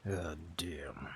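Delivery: low, soft, slurred "God damn"; mimicking a friend who mumbled God damn; friend's mumbled God damn. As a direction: drop it low and swallow the words. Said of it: "how one of my friends used to say".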